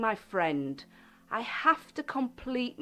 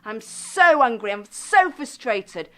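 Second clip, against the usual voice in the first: louder angry complaint, loudest on so angry; mock furious complaint about something gone wrong; acted anger; 6 dB louder than usual. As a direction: vent the frustration out loud. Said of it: "in the past you would have said".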